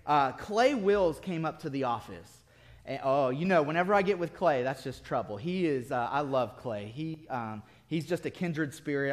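normal talking voice

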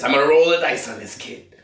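high, hammed-up New York tough guy; fake New York accent. Vlogger lapsing into his fake New York accent over the bong. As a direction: roll it out like a Brooklyn wise guy. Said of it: "New York guy shit".

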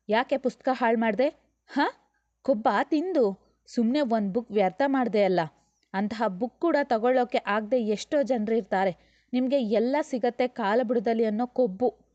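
loud, sharp telling-off; mother scolds the spoilt child hard; angry scolding. Acted. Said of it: "ಗದರಿ ಬಿಟ್ಟಳು ಜೋರಾಗಿ".

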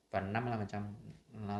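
ordinary talk

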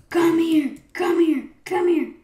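loud, high repeated command; shouted chase call. Pretend. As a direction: yell it in bursts at fleeing prey. Chasing a player.

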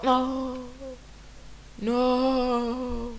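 high, long wailed "no"; high-pitched cry of dismay; drawn-out protest. Real, not acted. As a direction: stretch each "no" out high. Protesting a game mishap.

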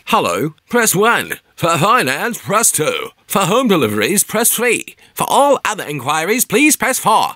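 like a 1912 Australian news bulletin; old radio news bulletin. Shown as a demonstration. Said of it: "sounded like Australia at nineteen twelve".

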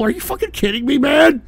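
incredulous anger. Real, not acted.

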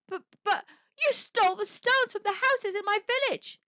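high, stammering shock. Acted. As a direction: stumble out the words aghast. Martin's accusing shock.

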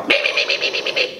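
unvoiced scolding me me me; mock angry mom. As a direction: imitate mom's mad nagging. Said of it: "mad at me and she go".

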